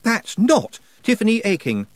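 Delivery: shocked realisation; startled boy. Acted. Roland stepping back from the stranger, startled.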